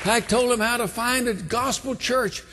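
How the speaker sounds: high, earnest sermon voice; preacher urging the congregation to passion; fervent preaching. Acted.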